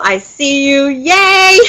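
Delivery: high excited squeal of yay; delighted cheer. Real, not acted. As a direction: cheer the viewer on, thrilled. Cheering a viewer in chat.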